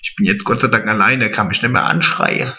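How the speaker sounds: normal talking voice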